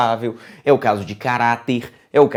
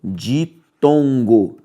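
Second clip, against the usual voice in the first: ditongo drawn out slowly, each syllable clear; slow emphasis; much slower than usual. Shown as a demonstration. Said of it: "o que nós chamamos na língua portuguesa de".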